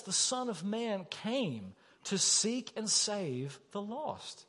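hushed, unhurried key line; slow, soft emphasis. Acted. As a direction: lay the verse down gently and deliberately. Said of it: "repeats the key verse".

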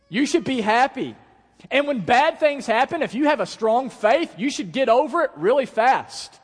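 high, loud, impassioned sermon delivery; booming, urgent pulpit voice; full-force preaching. Acted.

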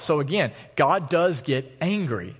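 usual speaking voice

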